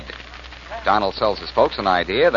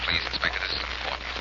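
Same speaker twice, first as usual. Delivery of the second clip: hushed urgent plea; 3 semitones lower, 9 dB quieter than usual. Acted. Pleading urgently on the phone.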